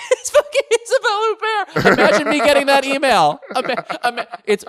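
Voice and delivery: laughing high and loud in disbelief; giddy laughing. Real, not acted. Host laughing to co-host about landing their guest. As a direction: burst out laughing at the name.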